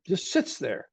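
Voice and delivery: normal talking voice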